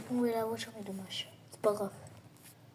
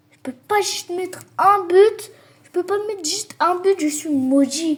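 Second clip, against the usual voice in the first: frustrated rant; 6 semitones higher, 9 dB louder than usual. Real, not acted. Raging he can't score.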